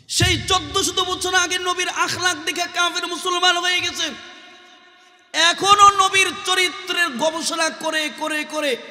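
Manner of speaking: high, sustained sermon chant; impassioned preaching. Acted. Preacher telling of conversions to Islam, high impassioned chant.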